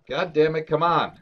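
loud, high frustrated cry; frustrated outburst. Real, not acted. Player furious that mining gives nothing, loud and annoyed. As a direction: snap at the game in frustration.

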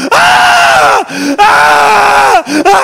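long, high, loud mock screams; imitated screaming. Comedian imitating his friend who won't stop, piercing screams. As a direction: scream on and on at full pitch. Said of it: "stop screaming, for fuck's sake".

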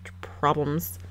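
soft, half-whispered prissy mimicry; mocking Hannah whisper. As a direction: breathe it out as a smug know-it-all. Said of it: "Hannah's like".

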